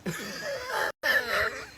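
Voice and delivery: high-pitched drawn-out laugh. Real, not acted. Joking with viewers about accents, high laugh. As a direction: let out a long, high giggle.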